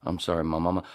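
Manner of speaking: ordinary talk, nothing marked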